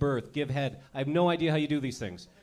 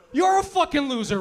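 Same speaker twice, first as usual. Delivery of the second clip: loud, higher shout; mocking buddy shout; 8 semitones higher, 7 dB louder than usual. Buddy Steve mocking him, loud shout. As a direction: yell it like a scornful bro. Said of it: "what's his buddy Steve gonna say".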